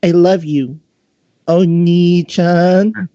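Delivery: put-on high, drawn-out girlish coo; sweetly dote on her big brother; high-pitched catgirl cooing at her brother; sweet little sister. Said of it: "with such a sweet smile".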